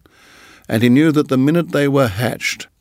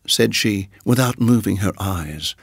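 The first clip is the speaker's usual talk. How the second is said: plain reading voice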